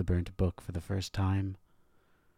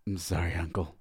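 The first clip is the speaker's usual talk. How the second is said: wheezing. A narrator's reading. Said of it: "Absinthe wheezed".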